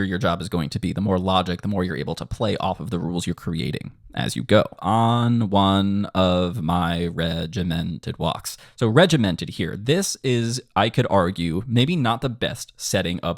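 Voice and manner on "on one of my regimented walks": slow, evenly drawn-out syllables; measured lyric rhythm. Shown as a demonstration. Composer demonstrates a lyric line for students, slow and measured. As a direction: stretch each word out along the beat.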